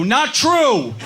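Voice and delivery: mock heckle from the crowd; heckler yell. Heckler in the audience calling out, mock yell. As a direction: call it out like a heckler. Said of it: "heard somebody in the audience go".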